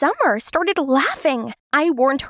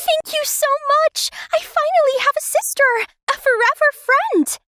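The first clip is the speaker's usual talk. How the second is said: put-on much higher, bright young girl thanking her mom; excited little girl. Little Summer thanks her new family, high and excited. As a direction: squeal your thanks like a thrilled little sister.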